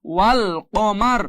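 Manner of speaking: ওয়াল কমার drawn out slowly, closed on R; stopped R ending. Shown as a demonstration. Teacher shows the reading at a pause, slow with R ending. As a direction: slowly read ওয়াল কমার with no vowel after the R. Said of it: "ওয়াকফ থাকার কারণে".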